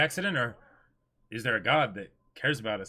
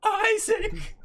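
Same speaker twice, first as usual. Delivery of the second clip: high shrieking call; 4 dB louder than usual. Acted. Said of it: "anime scene reenacted, calling out".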